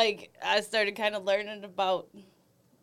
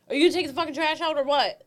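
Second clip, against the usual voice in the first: higher, sharp demand about the trash; stern mom voice; 8 semitones higher, 3 dB louder than usual. Pretend. Woman nagging her man about trash, stern mom voice. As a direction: nag him like you're fed up. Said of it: "You take that tone with me".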